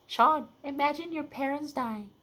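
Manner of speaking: high, slow teasing sister voice; mocking sister. Sister teasing Sean about his parents, mock high taunt. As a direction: mimic the sister, high and drawn out. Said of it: "my sister would make fun of me".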